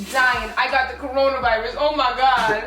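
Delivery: ordinary delivery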